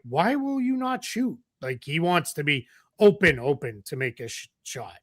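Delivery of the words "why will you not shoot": high-pitched mock exasperation; imitating frustrated fans. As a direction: plead like a baffled fan, pitched way up. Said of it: "some people might be super frustrated".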